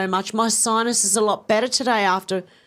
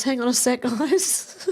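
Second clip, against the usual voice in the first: really choking up, breaking off high; tearful; 7 semitones higher, slower than usual. Real, not acted. Streamer excusing herself to blow her nose, tearful. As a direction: voice climbing high as tears take over. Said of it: "I've got tears coming out of my eyes".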